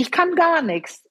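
high-pitched exasperated complaint; mock helpless moaning; retold grumbling. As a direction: imitate a fed-up patient griping. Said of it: "Und ich hab gemeckert".

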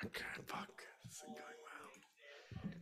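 breathless hushed whisper after the hit; whispered curse. Acted. Hurt adventurer whispers to his companion after taking damage. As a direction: whisper it like you're hurt and scared.

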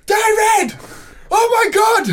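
high, loud shriek across the road; excited shout. Retold or imitated. Texan woman spotting him across the road, excited shout. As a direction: holler it like a thrilled fan. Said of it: "literally just shouts".

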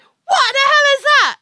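high, loud startled shriek; shocked. Retold or imitated. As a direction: shriek in sudden alarm. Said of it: "I'm just like".

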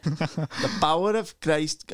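slow, giggling attempt at a Swedish accent that slips; laughing through it. Guest trying a Swedish chef exorcist line while cracking up laughing. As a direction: break up laughing mid-line. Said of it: "That was Welsh".

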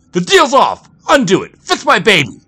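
high, frantic full-force yelling; cried out. A narrator's reading. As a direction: shout it while struggling to break free. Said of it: "Jack struggled and cried".

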